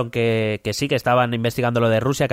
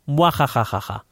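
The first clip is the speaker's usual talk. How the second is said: hammed-up evil laugh; mad scientist; 4 semitones higher than usual. Evil laugh after a plan. (risa malvada de científico loco)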